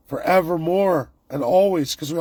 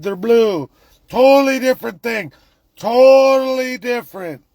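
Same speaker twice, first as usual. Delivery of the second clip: higher, louder mocking whine of denial; mock complacent Canadian; 5 semitones higher, 4 dB louder than usual. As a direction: ham up a naive voice insisting it's fine.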